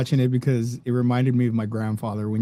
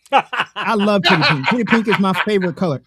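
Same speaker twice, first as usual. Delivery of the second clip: laughing through the words, higher; 4 semitones higher, 3 dB louder than usual. Real, not acted. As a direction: giggle out the line about the favourite color. Laughing while quoting grandpa.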